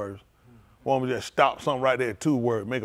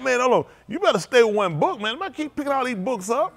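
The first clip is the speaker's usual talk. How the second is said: exasperated mock reply; 11 semitones higher, 5 dB louder than usual. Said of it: "I'm like".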